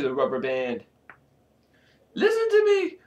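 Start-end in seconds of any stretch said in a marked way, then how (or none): 2.1-3.0 very high, shrill squeal; shrill, panicky voice; impatient scream. A narrator's reading. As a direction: screech it impatiently. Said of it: "I screamed impatiently".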